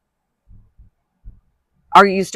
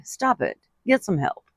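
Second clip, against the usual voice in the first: much softer mock Michael Jordan line; Michael Jordan impression; 3 semitones higher, 9 dB quieter than usual. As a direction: hush it down and tell them flatly to stop. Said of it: "As, uh, Michael Jordan would say".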